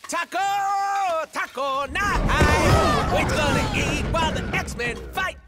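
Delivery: loud, high whooping cheer; boisterous shouted chant; rowdy celebration. Acted. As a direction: holler it with drawn-out glee. Whooping over taco night.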